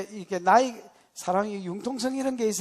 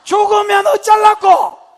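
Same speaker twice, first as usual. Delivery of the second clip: slow, high shout of worry; anguished shout; 19 dB louder, slower than usual. Acted. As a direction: demand it in anguish. Preacher shouts father's fear.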